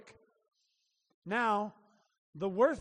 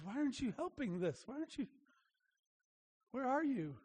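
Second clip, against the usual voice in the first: quiet anguished plea; 2 semitones higher, 6 dB quieter than usual. Retold or imitated. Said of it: "he feels, is".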